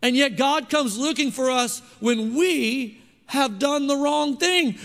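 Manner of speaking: ordinary talk, nothing marked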